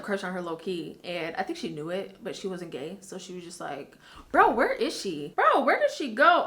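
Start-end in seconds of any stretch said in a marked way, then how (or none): 4.3-6.4 eager searching. Retold or imitated. Girl's excited quote.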